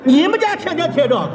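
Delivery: outraged echo. Real, not acted.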